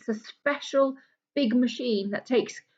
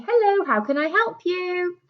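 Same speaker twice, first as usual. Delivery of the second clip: receptionist on the phone; 7 semitones higher than usual. Acted. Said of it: "there's a receptionist who takes the telephone calls".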